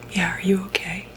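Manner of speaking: usual speaking voice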